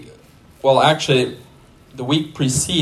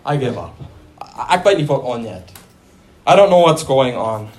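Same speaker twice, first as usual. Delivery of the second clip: fast, lower, rattled-off confusion; exasperated giving up; 3 semitones lower than usual. Retold or imitated. Preacher voicing a confused believer, fast and exasperated. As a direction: throw up your hands, talking fast. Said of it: "you're like".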